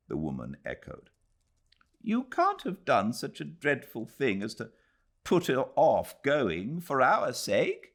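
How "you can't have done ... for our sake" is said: exclaiming. Acted. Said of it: "exclaimed Missus Moore".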